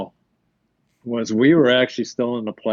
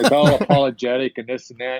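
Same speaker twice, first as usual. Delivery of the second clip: ordinary talk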